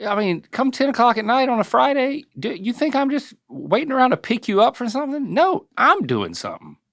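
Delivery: high-pitched mock protest; high mock indignation. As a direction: play the put-upon dad in a squeaky voice. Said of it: "I'm like".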